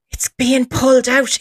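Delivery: high, alarmed imitation; first flush of put-on fright; mock alarm. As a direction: mimic rising panic, still contained. Mimics panicking skeptic, first take.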